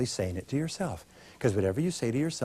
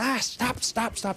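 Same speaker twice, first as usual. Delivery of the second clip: much higher, sudden cry of stop stop; mock alarmed stop; 10 semitones higher, slower than usual. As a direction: cut the dream off in a hammed-up panic. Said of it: "We go".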